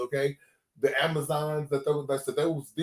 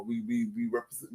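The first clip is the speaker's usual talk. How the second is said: ordinary talk, nothing marked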